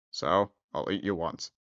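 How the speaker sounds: low, soft beast growl. Acted. Beast replies.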